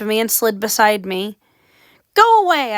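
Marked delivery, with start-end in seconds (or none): 2.1-2.8 much higher, louder shout; girl crying out at the ghost boys. A narrator's reading. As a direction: cry out to drive them off. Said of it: "I cried".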